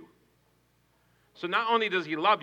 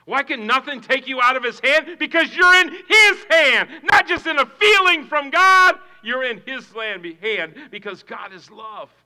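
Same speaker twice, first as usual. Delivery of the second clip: loud, high ringing question and answer; preaching at full force; 9 semitones higher, 15 dB louder than usual. Acted. Pastor pressing the point to the congregation. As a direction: drive it home.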